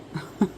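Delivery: brief chuckle; a small amused laugh between sentences; quick, soft chuckle; short laugh. Real, not acted. Amused while retelling the exchange.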